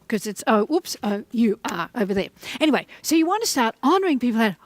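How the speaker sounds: ordinary talk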